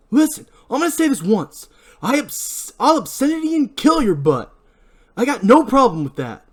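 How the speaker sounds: high menacing yell; angry shout. Acted. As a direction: threaten him at full voice. Barry holding ice hook.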